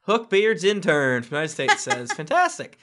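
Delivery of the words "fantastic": much higher, gushing one word. Acted. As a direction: gush it like a fan. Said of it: "Why would I say that?".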